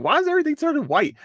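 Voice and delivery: high-pitched, rushed baffled question; caught off guard. Retold or imitated. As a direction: blurt it out fast and high, thrown off guard. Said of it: "it caught me off guard".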